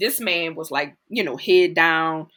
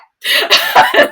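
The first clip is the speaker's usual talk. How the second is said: loud high breathy laugh; burst of laughter; 13 dB louder than usual. Real, not acted. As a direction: crack up at your own story. Laughing at her story.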